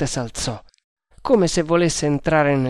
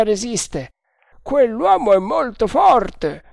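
a higher voiced guard, confident; overheard guard; 9 semitones higher, 4 dB louder than usual. A narrator's reading. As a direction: play the first guard, sure of himself. First guard boasting. (guardia sicura di sé, voce più alta)

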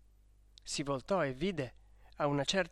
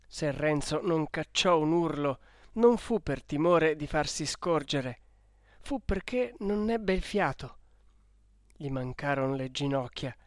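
plain reading voice

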